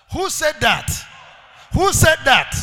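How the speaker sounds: rousing preacher shout; shouted question. Shown as a demonstration. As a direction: hammer the question at the crowd. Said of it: "repeated question, building".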